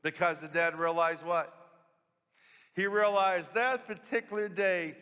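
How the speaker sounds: normal talking voice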